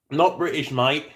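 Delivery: hammed-up British mate-speak; mock British accent. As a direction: put on a jokey British voice. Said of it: "If I were British".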